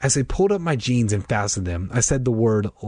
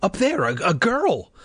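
frightened; 7 semitones higher than usual. A narrator's reading. Scared girl tells Dad.

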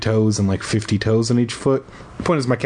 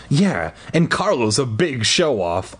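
ordinary delivery, nothing marked

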